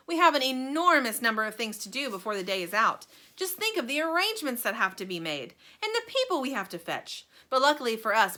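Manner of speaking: loud, bright showman chatter; excitable Wonka. Acted. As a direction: gush like a thrilled host. Wonka held lively voice.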